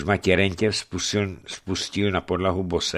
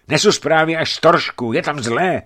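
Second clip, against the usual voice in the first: urgent news; 8 semitones higher, 7 dB louder than usual. Acted. Messenger announces bad news.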